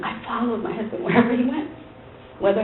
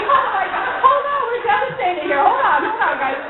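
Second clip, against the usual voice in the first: much higher, rushed laughing plea; frantic plea; 10 semitones higher than usual. Retold or imitated. Wife begging the pastors to hold on, high and frantic. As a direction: beg them to wait, half laughing. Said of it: "I was like".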